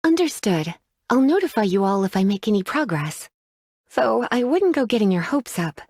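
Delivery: ordinary talk, nothing marked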